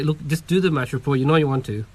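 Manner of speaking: normal talking voice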